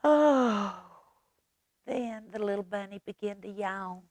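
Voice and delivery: usual speaking voice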